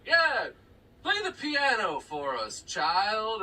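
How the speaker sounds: loud, high, slow grand request; showy TV parent. Retold or imitated. Movie parent asking child to perform, loud and proud. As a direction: imitate a parent showing off.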